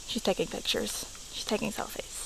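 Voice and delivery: soft unvoiced whisper. Real, not acted. Vlogger whispering about sister taking selfies, hushed whisper. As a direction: whisper it behind her back.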